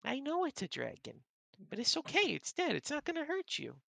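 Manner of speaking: very high reassuring cat voice; soothing cat. Acted. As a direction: gently talk a scared friend down. Rita keeps soothing.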